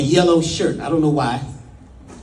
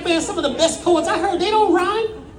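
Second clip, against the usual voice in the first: much higher-pitched comic objection; mock protest; 12 semitones higher than usual. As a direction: push back in a high, incredulous voice. Acted protest to Brother Rich.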